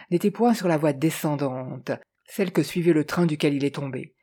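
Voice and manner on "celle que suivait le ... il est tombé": ordinary delivery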